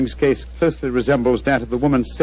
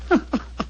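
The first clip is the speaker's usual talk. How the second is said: a soft breathy chuckle to himself; amused little chuckle; quiet chuckle; 12 semitones higher, 5 dB quieter than usual. Acted. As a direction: laugh privately at a private joke. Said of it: "What are you laughing about?".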